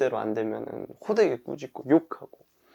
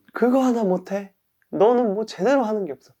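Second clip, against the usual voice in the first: high-pitched acted scolding; self-berating; 9 semitones higher, 4 dB louder than usual. Person who overslept berates themselves, angry scolding. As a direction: lay into yourself with raised pitch. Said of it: "막 욕하는 거예요".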